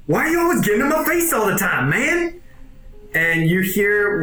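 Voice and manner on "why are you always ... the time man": high, fast, complaining voice; annoyed cyborg. Acted. Cyborg complaining to Wedge, high fast whine. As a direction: whine it out, pitch climbing at the end. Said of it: "He's like".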